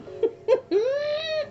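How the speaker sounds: a high burst of laughter. Real, not acted. Streamer laughing at her near fall. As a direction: let a squeal of a laugh out.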